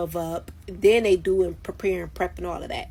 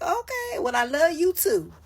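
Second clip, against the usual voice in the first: sarcastic high voice; 9 semitones higher than usual. Retold or imitated. Sarcastic inner quote.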